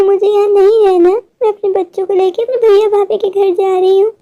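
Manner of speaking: ordinary talk, nothing marked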